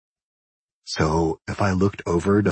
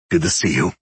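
choked up. A narrator's reading. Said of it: "I choked out".

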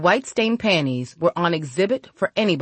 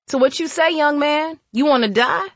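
higher-pitched, taunting threat; menacing challenge; 10 semitones higher than usual. A narrator's reading. Woman holds a gun on young man, menacing challenge. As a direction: dare the boy with rising questions. Mooney threatens the boy at gunpoint.

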